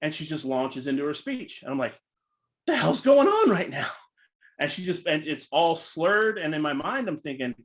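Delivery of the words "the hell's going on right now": high, loud what-is-happening cry; startled outburst. Retold or imitated. Speaker reacting to a drunk contestant, startled outburst. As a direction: blurt it out in shock.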